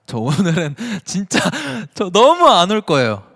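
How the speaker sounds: loud and high, laughing through it; overjoyed, voice bursting with laughter; excited laughing outburst. Real, not acted. Overwhelmed, laughing at surprise messages.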